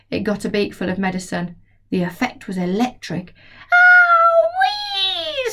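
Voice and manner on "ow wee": high, loud squawking shriek; hen's screech. Acted. The hen screeching after tasting the medicine. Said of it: "screeched the hen".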